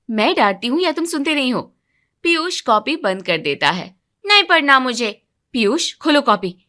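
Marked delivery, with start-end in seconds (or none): 4.3-5.2 put-on stubborn child. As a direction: dig your heels in like a sulky boy. Son refuses, slams notebook. (ज़िद्दी बच्चा, ज़ोर से मना करते हुए)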